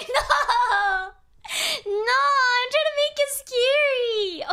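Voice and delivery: high, loud, drawn-out wail through laughter; laughing, squealed complaint; whiny protest. Real, not acted. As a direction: wail it in playful protest, stretching the words. Protests chat's teasing about scariness.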